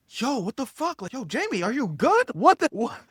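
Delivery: high, rising startled questions; bewildered alarm. Retold or imitated. Retells bewildered waking reaction.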